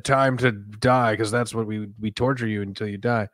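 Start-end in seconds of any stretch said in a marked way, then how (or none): 0.0-1.1 Pinhead line. Shown as a demonstration. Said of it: "I should have said".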